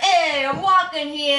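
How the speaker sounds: drawn-out mock New York accent; New Yorker accent. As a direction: imitate a stock New Yorker, slowly. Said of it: "they used to do New Yorker".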